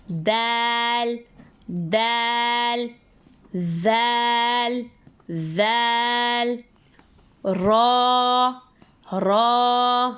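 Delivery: each Arabic letter drawn out long, said twice; slow letter revision. Shown as a demonstration. Teacher repeating each Arabic letter twice for students, slow and drawn out. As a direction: stretch every letter slowly like a recitation drill. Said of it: "एक बार फिर से दोहराते हैं".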